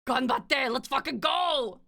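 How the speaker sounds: loud, high hype shout; hammed-up rallying cry; gung-ho character. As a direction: yell it like a pumped-up cheer. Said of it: "she was kinda like".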